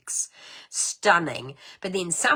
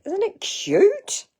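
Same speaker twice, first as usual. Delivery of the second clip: high, slow, louder gush; gushing cute; 9 dB louder, much slower than usual. Real, not acted. Crafter showing card to viewers, gushing high and slow. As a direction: coo over how cute it is.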